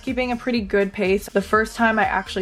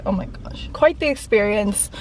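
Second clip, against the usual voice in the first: ordinary talk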